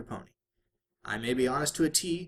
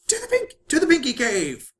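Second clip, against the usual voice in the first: very high, loud heroic cry; gleeful shout; 13 semitones higher, 11 dB louder than usual. A narrator's reading. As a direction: call it out like a cartoon hero taking off. Pinkie shouts flying off.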